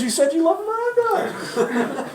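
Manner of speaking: very high, loud, drawn-out imitation; mock protest. Salesman defending the surprise celebrity visit, high laughing protest. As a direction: squeal it back in laughing self-defense.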